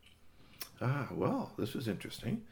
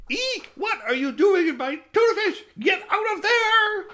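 shrieking mom; 9 dB louder than usual. Acted. Mother Sweet Bones shrieks at the cat in her tuna, high and loud. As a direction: screech it like a startled mother.

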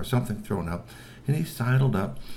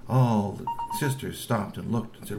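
ordinary delivery

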